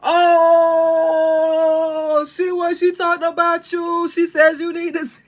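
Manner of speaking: high, loud excited rant. Retold or imitated. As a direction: gush it loudly. [name] reacting retold.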